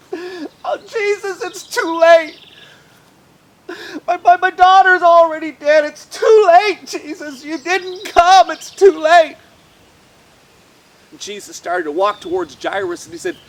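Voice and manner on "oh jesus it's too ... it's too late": high, loud grieving protest; despairing. A narrator's reading. Father telling Jesus his daughter's dead, despairing. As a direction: cry out in despair.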